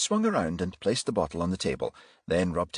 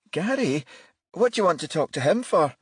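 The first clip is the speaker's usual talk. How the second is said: put-on high, anxious older woman; worried, faltering woman; nervous mother; 12 semitones higher, 4 dB louder than usual. A narrator's reading.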